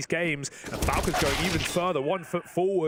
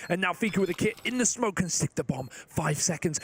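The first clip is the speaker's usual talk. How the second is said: ordinary talk, nothing marked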